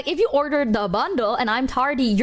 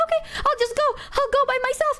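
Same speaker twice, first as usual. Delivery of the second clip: eager quote; 13 semitones higher, much faster than usual. Retold or imitated. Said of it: "And I was like".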